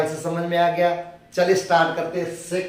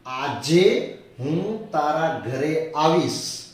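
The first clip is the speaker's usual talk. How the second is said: slow dictation; 3 semitones lower, much slower than usual. Shown as a demonstration. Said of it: "अब Gujarati sentence".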